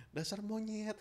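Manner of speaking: soft, gentle name-calling; a half-hearted insult gone soft; softened insult. Shown as a demonstration. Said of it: "Ngomongnya aja jadi halus".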